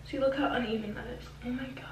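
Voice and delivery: fast hushed dismay; whispering. Real, not acted. Uneven cut, hushed dismay.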